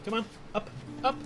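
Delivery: high, light coax to the dog. Real, not acted. Coaxing the dog to jump.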